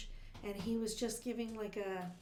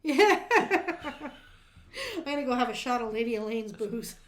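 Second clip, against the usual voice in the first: ordinary talk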